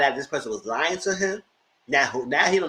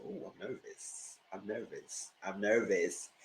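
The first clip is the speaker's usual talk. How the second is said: hushed, voiceless fearful whisper; nervous whisper; 5 semitones lower, 12 dB quieter, slower than usual. Retold or imitated. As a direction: whisper it as if hiding from the noise. Scared after the bang.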